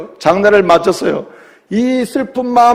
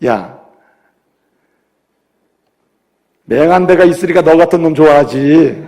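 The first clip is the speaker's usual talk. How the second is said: teasing God; 4 semitones lower than usual. Acted. God's punchline in joke.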